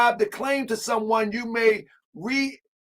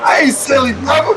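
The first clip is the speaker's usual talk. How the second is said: loud retort; 5 semitones higher, 10 dB louder than usual. Real, not acted. Talks back to the clip.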